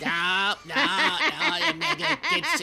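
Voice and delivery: high, loud laughing denial; laughing protest. Real, not acted. Guest laughing off the host's teasing, high and loud.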